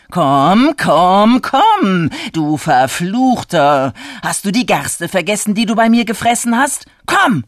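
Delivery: loud, angry summons; calling out. A narrator's reading. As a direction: holler for a runaway animal, building to a high shout. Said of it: "wedelte mit dem Gras und rief".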